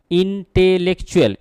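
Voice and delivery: Intellectual sounded out syllable by syllable; teacher showing where intellectual breaks, each syllable set apart; broken into syllables. Shown as a demonstration. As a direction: split intellectual into distinct, measured beats. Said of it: "এই শব্দটাকে ভাঙা যাচ্ছে".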